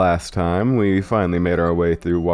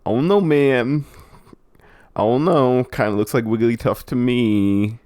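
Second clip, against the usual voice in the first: high, slow, stretched-out oh no; drawn-out dismay; 5 semitones higher, slower than usual. Real, not acted. Player recognizing the shadow in a vision, drawn-out dismay. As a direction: groan it out high, then ease down.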